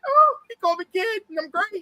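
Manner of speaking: put-on high, thrilled kid voice; giddy excitement. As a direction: squeal it like a starstruck fan. Retelling a nervous young actor's joy.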